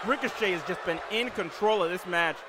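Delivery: excited live play-by-play call; wrestling commentator. Acted. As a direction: call the match like a ringside announcer.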